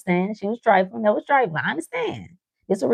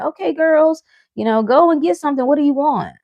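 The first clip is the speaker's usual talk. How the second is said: higher, a touch sweet parent voice; friend's parent; 8 semitones higher, 3 dB louder than usual. Retold or imitated. A friend's parent offering the girls a treat, higher. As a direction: offer like a kind host mom. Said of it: "their parents would be like".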